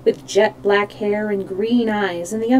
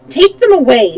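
louder, higher order; commanding; 4 semitones higher, 8 dB louder than usual. A narrator's reading. Queen ordering her guards to remove the captives, loud command. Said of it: "she commanded the guard".

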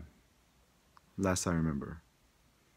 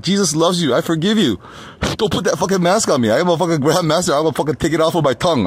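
defiant ranting; 6 semitones higher, 9 dB louder, faster than usual. Retold or imitated. Retold defiance at medics.

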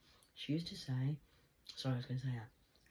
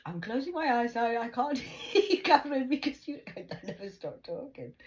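laughing protest; 6 semitones higher, 7 dB louder than usual. Retold or imitated. Grandma trying to sleep.

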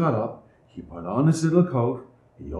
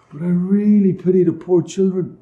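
higher, louder regret; father to his wife, higher and sorrowful; 8 semitones higher, 4 dB louder than usual. A narrator's reading. Father laments.

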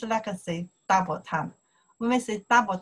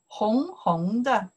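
slow model word; 3 semitones higher, slower than usual. Shown as a demonstration. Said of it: "We like to pronounce double time".

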